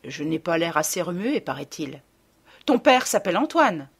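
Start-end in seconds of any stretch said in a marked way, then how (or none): 2.7-3.9 insistent mother, a touch higher and louder; mildly irritated reminder. Retold or imitated. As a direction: hammer the name home. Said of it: "d'un ton un peu irrité". (rappel un peu agacé de la mère)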